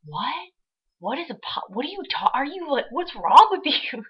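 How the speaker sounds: confused customers. Retold or imitated. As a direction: play the puzzled customer hearing pop. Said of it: "people would look at me like".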